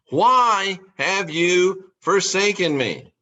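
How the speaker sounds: slow, drawn-out echo of the cry; slow lament. Retold or imitated. Jesus repeating his cry to God, slow and mournful. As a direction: let it sink, slow and mournful. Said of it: "he would cry out".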